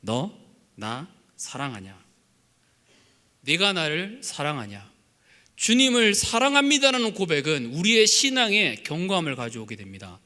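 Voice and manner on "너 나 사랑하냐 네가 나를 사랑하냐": tender question. Acted. Jesus's question quoted softly.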